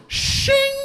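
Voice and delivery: high, ringing "shing"; sword-drawing sound. Retold or imitated. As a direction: imitate a blade sliding out of its sheath. Imitates a sword being drawn.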